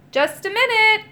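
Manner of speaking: high, loud call from inside. A narrator's reading. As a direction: call through a closed door. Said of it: "called a voice".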